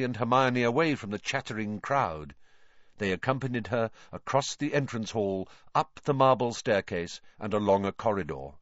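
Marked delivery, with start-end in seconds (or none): none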